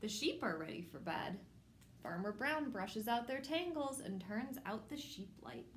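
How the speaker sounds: ordinary delivery, nothing marked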